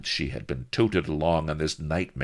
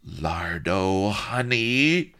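hiss; 5 semitones higher, 5 dB louder, much slower than usual. Acted. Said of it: "hissed the viceroy".

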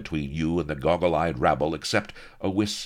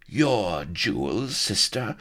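drawn-out, higher sneer ending in a spat exclamation; oiled-gravel voice; 6 semitones higher, slower than usual. A narrator's reading. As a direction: sneer it slowly, rising on the question. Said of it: "Sheba's voice was like oiled gravel".

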